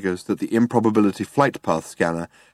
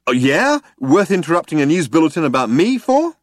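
raised, higher-pitched retort; indignant; 7 semitones higher, 5 dB louder than usual. A narrator's reading. Zaphod objects to Trillian interrupting news about him, indignant. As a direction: put out and challenging, pitched up.

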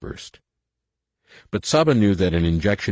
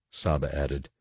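ordinary delivery

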